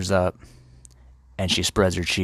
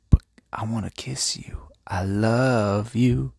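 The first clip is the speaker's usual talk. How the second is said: amorous whispered plea; 4 semitones higher than usual. Acted. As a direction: whisper it like a lovesick suitor, stretching the confession. Fuckboy woos the wife.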